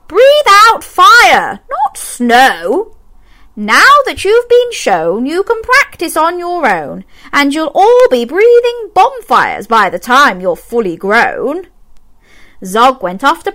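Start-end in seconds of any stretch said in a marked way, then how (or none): 0.1-11.7 Madam Dragon teaching. A narrator's reading. Dragon teacher instructing her class, higher and emphatic. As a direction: lay the lesson out firmly. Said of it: "said Madam Dragon".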